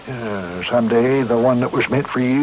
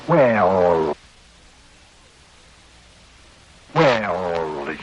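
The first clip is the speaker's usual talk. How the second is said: hedging reluctance; 6 semitones lower than usual. Acted. Cornered mayor hedges, drawing it out.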